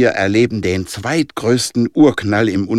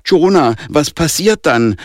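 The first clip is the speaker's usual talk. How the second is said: mildly desperate, higher-pitched question; 6 semitones higher, 5 dB louder than usual. A narrator's reading. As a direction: call out louder for an answer, a touch of despair. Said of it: "fragte Andy verzweifelt".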